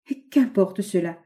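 plain reading voice

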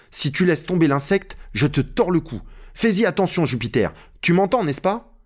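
high-pitched urgent warning; Legrand, threatening master. Acted. Narrator voices Legrand threatening Jupiter. (Legrand, avertissement pressant)

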